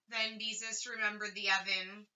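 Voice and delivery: ordinary delivery, nothing marked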